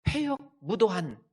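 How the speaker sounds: loud, high outburst, slowly stretched; acting out a furious king's rebuke; angry Saul. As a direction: thunder the insult out word by word. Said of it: "사울이 요나단에게 화를 내며".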